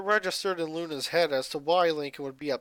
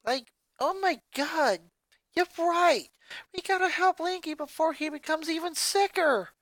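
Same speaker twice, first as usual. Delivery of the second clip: sincere worry; 12 semitones higher than usual. Acted. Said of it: "the sad expression on her face proving that her words were sincere".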